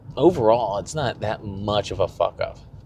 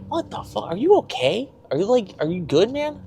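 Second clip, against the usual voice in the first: much higher, alarmed voice for the dad; worried dad; 10 semitones higher than usual. Retold or imitated. Dad alarmed at his son's stroke scare, high worried voice. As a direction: voice it high, like a dad panicking over his son. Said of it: "Uh, he's like".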